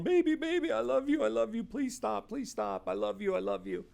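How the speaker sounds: mock falsetto pleading, baby I love you; high pleading husband. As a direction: imitate a panicked man begging his wife to stop. He's there going.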